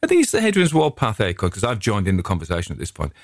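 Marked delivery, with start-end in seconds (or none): 0.0-1.5 high-pitched butting-in question; unhelpful interjection. Retold or imitated. Walker butts into the conversation about acorns, high unhelpful query. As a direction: chime in high, retelling your own interruption. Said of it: "I'm unhelpfully say".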